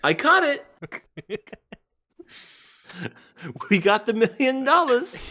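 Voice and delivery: mock triumphant catch. Joking to co-hosts about the giant-handed fisherman, mock triumphant. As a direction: crow it in a high voice, on the edge of laughter.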